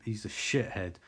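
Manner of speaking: usual speaking voice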